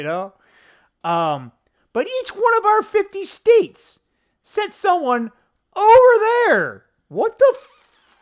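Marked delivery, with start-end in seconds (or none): 1.9-6.8 high, loud disbelief, stretching over there; incredulous. Real, not acted. Disbelief at officials sent. 7.1-8.2 high, slow, breaking off before the swear; incredulous, cut off. Real, not acted. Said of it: "I'm gonna say it".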